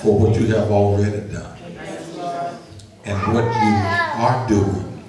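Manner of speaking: steady, measured public prayer; earnest prayer. Acted. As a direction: pray aloud before the congregation, deliberate and sincere.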